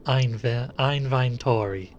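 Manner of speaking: "Einveintori" drawn out with eye-sounds, EYEN-vain-tory; odd pronunciation. Shown as a demonstration. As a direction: say "Einveintori" slowly, like counting in a foreign language. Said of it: "sounds like I'm counting in a foreign language".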